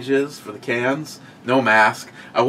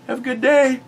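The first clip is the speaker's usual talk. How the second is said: mock cheerful clerk; 12 semitones higher than usual. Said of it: "she's like".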